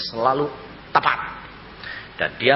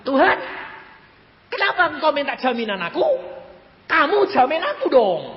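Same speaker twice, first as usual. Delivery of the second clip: high, loud retort to God, re-enacted; acted loud challenge; indignant protest; 6 dB louder, faster than usual. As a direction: throw the demand back insistently.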